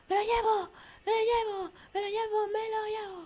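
high, repeated sing-song chant; high-pitched looting glee; gleeful grabbing. Real, not acted. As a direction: chirp it greedily as you scoop up loot. Gleefully grabbing all the loot.

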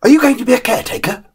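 high, fast eager question; dog's eager question. A narrator's reading. Young dog asking the man eagerly. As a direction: pipe it up curiously. Dog narrator voicing himself.

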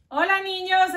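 ordinary delivery

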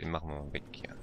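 hushed whisper while sneaking up. Real, not acted. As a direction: breathe the words, no voice.

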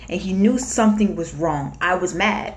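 usual speaking voice

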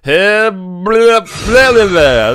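high, loud silly blah blah; silly squeak. Pretend. Gamer squealing nonsense into the mic.

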